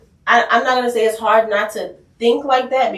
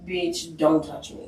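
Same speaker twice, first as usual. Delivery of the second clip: softer, breathy warning to back off; hissed rebuff; 4 semitones lower, 8 dB quieter, slower than usual. Retold or imitated. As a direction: push them away under your breath. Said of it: "my sister is just like".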